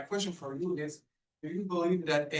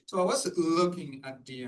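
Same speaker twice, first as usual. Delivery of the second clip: raised voice to be heard; speaking up; 4 dB louder than usual. Real, not acted. Questioner restarts after being asked for volume, speaking up. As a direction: restart the question, louder for the room. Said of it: "A little louder, please".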